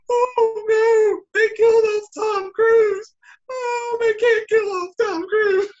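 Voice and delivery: very high, loud hammed-up wail; mock crying. Audience behind him reacting to Tom Cruise's death, mock wailing. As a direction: imitate distraught moviegoers bawling. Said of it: "people behind me crying".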